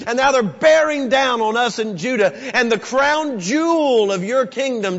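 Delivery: pleading with God at full volume, pitched high; loud pleading prayer; fervent shouted prayer. Acted. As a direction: cry out to God like a desperate king. Preacher acts out Hezekiah's prayer.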